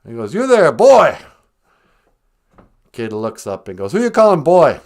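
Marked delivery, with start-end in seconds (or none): 0.3-1.2 loud, high hail from a window; theatrical Scrooge shouting to a boy; calling out. Acted. 3.9-4.8 loud, high comic retort; acted affronted comeback; indignant kid.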